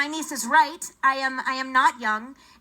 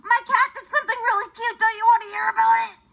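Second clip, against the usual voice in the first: high, pleading rush to keep the caller on; pleading to stay on; 9 semitones higher, 5 dB louder than usual. Retold or imitated. Comic begging a customer service agent to keep listening. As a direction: beg him not to hang up, voice way up. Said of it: "I'm like".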